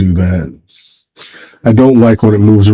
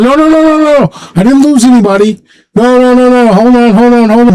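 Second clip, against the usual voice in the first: much higher, frantic no no no; flustered denial; 12 semitones higher than usual. Retold or imitated. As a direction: rush to stop the misunderstanding, voice leaping up. Frantically denying anyone died.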